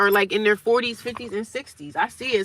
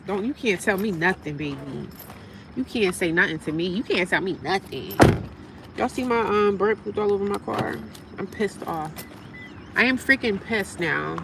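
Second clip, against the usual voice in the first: normal talking voice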